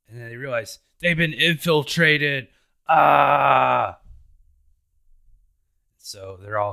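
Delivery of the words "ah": loud, higher alarmed yell; startled shout. Acted. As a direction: cry out in sudden shock. Realising they've been infiltrated.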